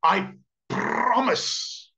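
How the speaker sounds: high, very drawn-out promise with a long rolled R; hammed-up, stretched-out vow; pleading snake. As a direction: plead slowly in a put-on snake voice. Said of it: "The snake pleads with him".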